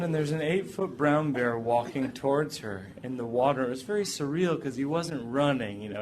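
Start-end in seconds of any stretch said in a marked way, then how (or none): none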